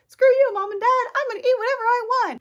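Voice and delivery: very high, loud, fast mock tantrum; acted defiant outburst. Speaker defies Mom and Dad over food, acted defiant outburst. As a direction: yell it like a rebelling kid.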